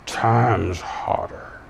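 slow, low, menacing king's threat. Retold or imitated. The king threatening the three men, slow low menace. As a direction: drag out the threat like a furious king. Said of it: "He said".

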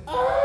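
sudden high yelled charge cry; scream. Retold or imitated. Preacher reenacting charging the dogs, high scream. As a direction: shriek to scare off dogs. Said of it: "this is what I did".

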